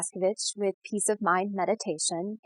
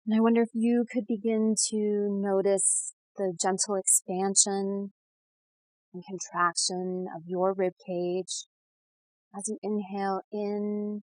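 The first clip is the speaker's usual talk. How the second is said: guided meditation; 4 dB quieter than usual. Real, not acted. Guide leading listeners to settle and breathe, soothing meditation voice. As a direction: ease the listener gently into calm, unhurried.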